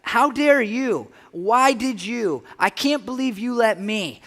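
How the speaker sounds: a sermon voicing anger at God; angry outcry. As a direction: hurl each question upward, slowly and loudly. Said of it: "we say".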